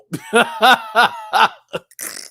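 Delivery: loud high burst of laughter; startled laugh. Real, not acted. Host seeing a friend's tip, loud burst of laughter. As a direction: crack up loudly.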